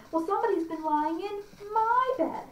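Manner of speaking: high-pitched indignant bear; middle-sized bear. Acted. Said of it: "sized bear says".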